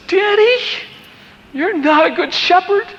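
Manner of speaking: very high-pitched imitated child's voice; crying little girl. Daughter to daddy at the pit of dead lambs, imitated high tearful cry. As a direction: play a tearful seven-year-old accusing her dad. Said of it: "And she starts crying".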